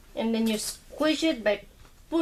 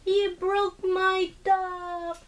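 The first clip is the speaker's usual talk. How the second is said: mock dismay; 8 semitones higher, much slower than usual. Mom pretends shock at son.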